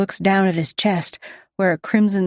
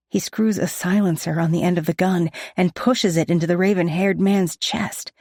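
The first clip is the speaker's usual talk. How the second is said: ordinary delivery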